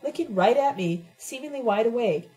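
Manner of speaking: ordinary delivery, nothing marked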